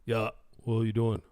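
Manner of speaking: put-on manly monotone; mock dude voice; straight-guy voice. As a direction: imitate a typical macho guy. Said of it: "They're generally talking like that".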